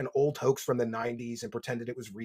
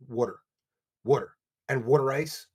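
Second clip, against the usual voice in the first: Philly accent; 4 dB louder than usual. Shown as a demonstration. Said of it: "when Philly people say".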